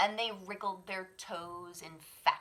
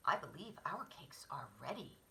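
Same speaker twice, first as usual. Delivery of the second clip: low mother bear; 5 semitones lower, 4 dB quieter, faster than usual. Acted.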